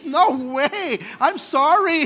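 No way, I'm sorry blurted out loud and high; a scandalised churchgoer backing off loudly; loud refusal. Acted. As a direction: recoil from it with a loud, high protest. Churchgoer refusing spit healing.

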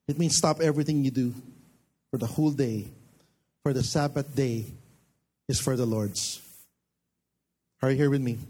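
usual speaking voice

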